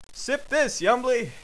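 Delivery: high-pitched challenge; defiant taunt. Acted. Schnorks tosses a bomb at Yumbly, defiant. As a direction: call it out high and punchy.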